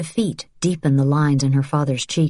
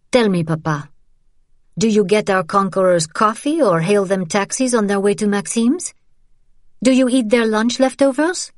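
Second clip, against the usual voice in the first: high, scornful taunting; 6 semitones higher than usual. Acted. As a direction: taunt him with contempt. Daughter mocks father.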